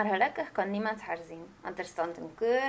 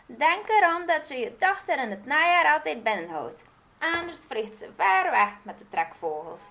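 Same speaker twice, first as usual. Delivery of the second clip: higher, louder witch warning; calling warning; 6 semitones higher, 6 dB louder than usual. Acted. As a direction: call the warning after her. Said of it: "riep de heks er achterna".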